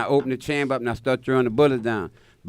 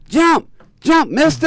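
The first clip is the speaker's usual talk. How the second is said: high, loud mock kid yell; high kid yelling from the fence; kids hollering; 7 dB louder, slower than usual. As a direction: shout it like a jeering kid. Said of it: "start hollering".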